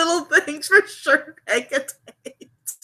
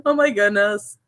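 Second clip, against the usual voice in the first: ordinary talk, nothing marked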